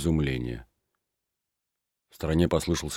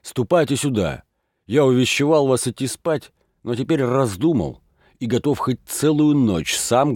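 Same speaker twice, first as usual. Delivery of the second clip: rousing call; 6 semitones higher, 4 dB louder than usual. A narrator's reading. Levko rallies the lads.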